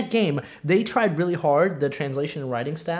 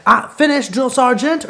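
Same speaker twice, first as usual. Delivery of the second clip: mock voice high and loud; imitating Hanks slipping out of accent; Tom Hanks' own voice; 8 semitones higher, 9 dB louder than usual. Retelling Forrest Gump's slip, higher and louder. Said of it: "breaks character in regards to his accent".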